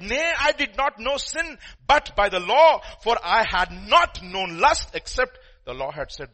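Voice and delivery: loud, declaiming Bible reading; scripture read with fervour. Acted. Preacher reading Romans chapter seven to the church, declaiming. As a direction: proclaim the verse to the hall.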